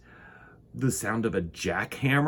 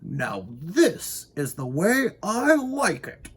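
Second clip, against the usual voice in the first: high, slow, savouring delight; contented Winky; 8 semitones higher, slower than usual. Acted. Winky pleased with his ticking clocks. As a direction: sigh it out like a happy old man. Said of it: "said Mister Winky".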